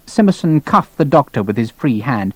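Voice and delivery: plain reading voice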